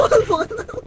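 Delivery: quick nervous laugh after a scare; a relieved little laugh after nearly dying; short laugh. Real, not acted. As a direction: let out a brief relieved laugh. Laughs at own close call.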